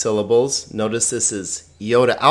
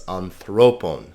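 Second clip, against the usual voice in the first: anthropon with the accent on the middle syllable; stress on THRO; 4 semitones lower than usual. Shown as a demonstration. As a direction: hit "thro" in anthropon, then fall. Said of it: "because thro has the accent".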